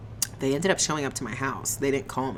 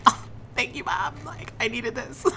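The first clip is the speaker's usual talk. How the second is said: laughing thanks; 10 semitones higher than usual. Retold or imitated. Thanking mom while laughing.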